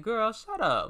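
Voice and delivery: high, loud disbelief; incredulous outburst. Retold or imitated. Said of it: "I was like".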